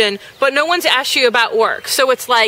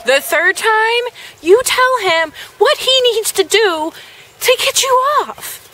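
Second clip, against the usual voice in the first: much higher, building emphasis; pushing the reader to speak up; emphatic; 9 semitones higher than usual. Real, not acted. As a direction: rise to an emphatic lecture. Advice rising in emphasis.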